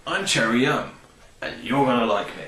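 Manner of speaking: playfully voiced flavour introducing itself; a light pretend voice for a personified flavour; gently insinuating flavour. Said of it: "insinuates very gently and goes".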